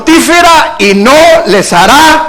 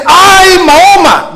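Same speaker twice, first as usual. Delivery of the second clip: mock cry to Mahoma; 8 semitones higher, 4 dB louder, slower than usual. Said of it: "usted no oye que la gente dice".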